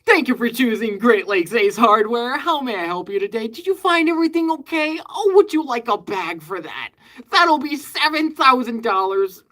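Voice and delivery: high, loud, over-sweet store greeting; fake cheerful cashier. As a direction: ham up a chirpy scripted cashier. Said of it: "I don't go like".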